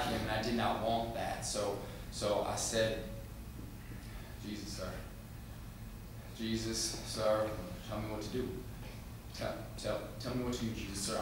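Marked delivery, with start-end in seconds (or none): 4.4-11.2 soft, halting, timid plea; hushed pleading prayer. Acted. Boy talks to Jesus out of Daddy's hearing, hushed pleading. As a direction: plead quietly, stumbling over the words. Boy prays secretly for guidance.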